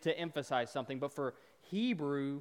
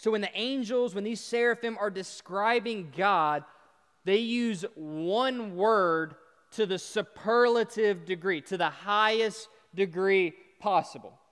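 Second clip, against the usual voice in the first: raised, higher preaching voice; emphatic preaching; 5 semitones higher, 7 dB louder than usual. Real, not acted. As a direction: drive the point home to the congregation.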